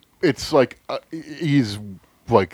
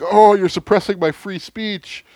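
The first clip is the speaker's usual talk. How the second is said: high, louder mocking whine; whiny mock quote; 11 semitones higher, 7 dB louder than usual. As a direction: whine it like a spoiled complainer. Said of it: "they're like".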